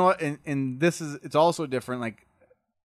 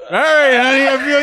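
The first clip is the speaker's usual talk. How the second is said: tipsy husband; 9 semitones higher, 8 dB louder than usual. Retold or imitated. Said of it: "they're like".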